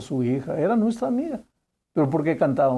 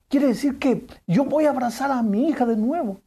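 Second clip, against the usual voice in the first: hopeful amazement; 8 semitones higher than usual. Retold or imitated. Father hopes to hug daughter.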